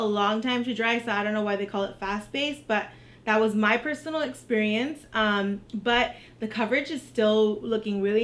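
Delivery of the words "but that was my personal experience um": ordinary talk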